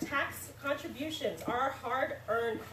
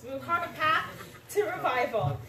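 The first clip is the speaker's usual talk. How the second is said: higher and louder, composure regained; recovered, emphatic; 5 semitones higher, 5 dB louder than usual. Real, not acted. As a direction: push through the nerves with force. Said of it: "I'm sorry, I'm a little nervous".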